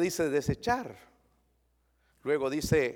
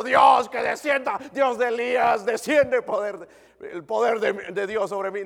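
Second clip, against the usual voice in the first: mock shouted prayer; 6 semitones higher, 9 dB louder than usual. Pastor mocking hour-long pleading prayers, loud mock shout. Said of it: "No necesito una hora rogando".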